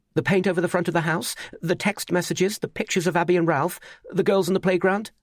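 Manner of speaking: cornered man pressing his suspect, urgent; agitated pressing. A narrator's reading. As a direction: pile the accusations on anxiously. Narrator presses Mutunda, rattled.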